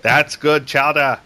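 slow, drawn-out New England accent. Shown as a demonstration. Host chimes in on the demo, New England accent. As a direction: stretch "chowder" in New England style. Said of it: "what about New England accent".

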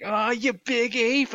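high, loud, slow cartoon exclamation; cartoon voice impression. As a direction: imitate an exasperated cartoon hero, stretching the words. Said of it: "he's doing that voice".

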